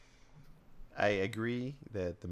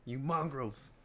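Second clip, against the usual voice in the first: high, loud pretend outrage; mock furious rant; 14 semitones higher, 6 dB louder than usual. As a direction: hurl it like a cartoon villain.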